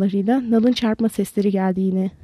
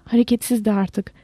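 ordinary delivery, nothing marked

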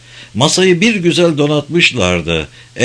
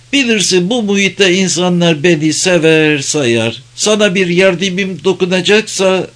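higher character voice for the tavern keeper; lightly voiced tavern keeper, obliging; 7 semitones higher than usual. A narrator's reading. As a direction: lightly play an old friend offering help. Tavern keeper offers his help.

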